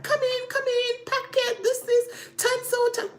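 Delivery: high, called-out directions to a driver; calling out commands. Retold or imitated. The husband calls out to the woman parking, high and carrying. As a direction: wave the car in, calling high.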